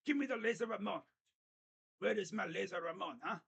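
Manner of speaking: a hammed-up Italian accent, laughing through it; light comic Italian voice, half laughing; mock Italian accent. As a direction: play a pushy Italian asking for Laser Ramon. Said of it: "He's Italian. That's like an Italian".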